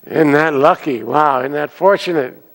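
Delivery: loud, high, cheery; congratulating the farmer's luck; excited neighbor. Acted. Neighbor showing up again, loudly celebrating.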